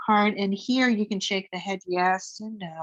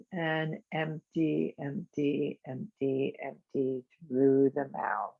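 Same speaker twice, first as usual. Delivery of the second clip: each empty softer and lower, fading out; fading exhale cue; 5 semitones lower, 6 dB quieter, slower than usual. Shown as a demonstration. Teacher cueing the exhale, fading softer each time. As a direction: let the voice empty out with the breath. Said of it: "fading empty cue".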